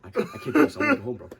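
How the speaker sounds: high, loud whiny panic, half laughing; mock panicked whine. Scared friend begging to go home, mock panicked high whine. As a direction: play the scared kid begging to leave.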